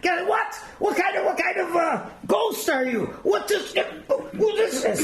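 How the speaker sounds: high-pitched, stammering shock; sputtering, high disbelief; astonished commandant. Retold or imitated. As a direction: act out a flabbergasted officer. Commandant shocked by the hairy palm.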